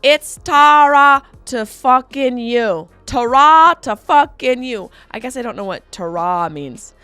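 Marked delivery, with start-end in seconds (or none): 0.0-4.9 put-on slow, loud Liverpool Scouse accent; loud slow Scouse catchphrase; Scouse impression. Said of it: "this is what he says".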